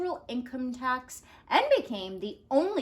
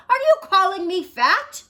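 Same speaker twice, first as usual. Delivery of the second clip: offended shout; 9 semitones higher, 10 dB louder than usual. Acted.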